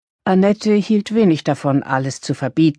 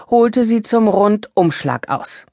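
usual speaking voice

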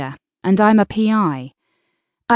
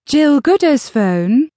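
higher, lilting sing-song phone greeting; 5 semitones higher than usual. A narrator's reading. Sister answering the narrator's phone, sing-song. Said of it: "she said in a sing-song voice".

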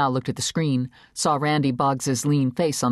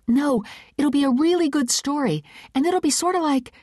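clumsy nervous; 13 semitones higher than usual. A narrator's reading. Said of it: "heard her clumsy words".

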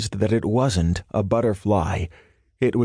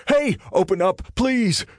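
desperate shout; 12 semitones higher than usual. A narrator's reading. Man begging someone to open door, high strained shouting. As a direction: beg at the top of the voice. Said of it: "he shouted".

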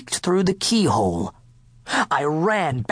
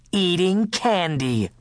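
usual speaking voice

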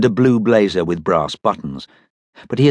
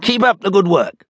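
captain's voice; 11 semitones higher than usual. Acted. Captain praises mechanics.

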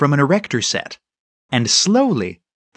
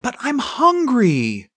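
put-on high, drawn-out child's wail; whining protest; 9 semitones higher, slower than usual. A narrator's reading. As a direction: stretch it out like a hungry kid pleading. Boy keeps wailing for pie.

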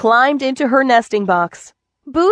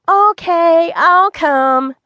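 put-on higher, louder young girl agreeing; little girl voice; 7 semitones higher, 4 dB louder, much slower than usual. Mother asks Avon to leave the TV, little girl agrees. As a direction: play the four-year-old giving in.

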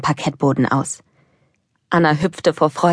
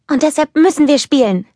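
put-on high, bubbly child voice full of eagerness; a wide-awake kid who can't sit still; excited little girl; 10 semitones higher, 4 dB louder than usual. Little Anna eager to play. (aufgeregtes kleines Mädchen, hohe Stimme)

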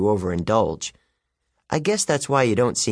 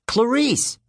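yelled; 13 semitones higher than usual. A narrator's reading. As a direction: call out sharply across the alley. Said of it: "I yelled".

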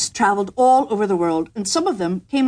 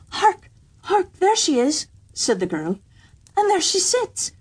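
much higher, eager pointing out the bird; excited little girl; 10 semitones higher than usual. A narrator's reading. Kitchen girl spotting the nightingale, excited and high. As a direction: bubble up with delight.

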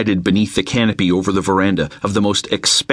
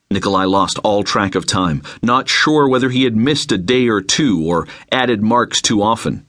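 ordinary delivery

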